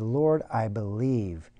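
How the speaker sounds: slow, soft confession; humble and sincere, very slow; healed man worshipping Jesus, soft and slow; reverent. Acted.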